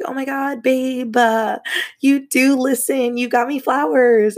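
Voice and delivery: put-on high, gushing thrilled girlfriend; gushing excitement. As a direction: squeal it like he finally listened. Thrilled at receiving the flowers.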